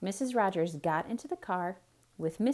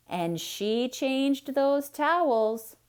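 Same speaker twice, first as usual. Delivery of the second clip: higher, slow punchline; dramatic reveal; 7 semitones higher, 5 dB louder, much slower than usual. A narrator's reading. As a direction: land the joke slowly. Narration building to punchline.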